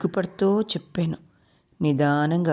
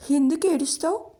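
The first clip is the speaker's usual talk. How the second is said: put-on child's question; 10 semitones higher than usual. Child Meera asks crying mother.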